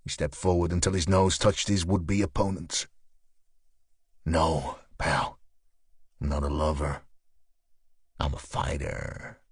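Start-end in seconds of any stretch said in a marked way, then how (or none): 4.2-9.4 low, soft, menacing threat. Acted. Nose to nose with the thug, a low quiet threat.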